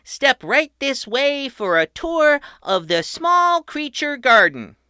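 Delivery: put-on high, loud tour-guide kid; proud boy host. A narrator's reading. Patrick welcoming his family underground, high and proud. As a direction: announce it like a grand showman. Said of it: "Patrick said".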